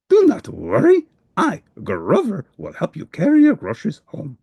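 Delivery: very high, bright helper voice; a helpful monster rushing to reassure; eager Grover. Acted. Grover offers help.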